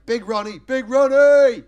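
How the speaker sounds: loud hail across the garden; calling out. Retold or imitated. Comic introducing his neighbour with a loud hail. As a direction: shout his name like a big introduction.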